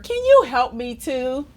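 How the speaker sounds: ordinary delivery, nothing marked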